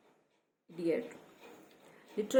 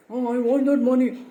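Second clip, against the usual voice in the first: loud, higher, grasping mock voice; mocking greedy voice; 4 semitones higher, 12 dB louder than usual. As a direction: sneer it like a greedy leech. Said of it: "how rude and how ugly it is".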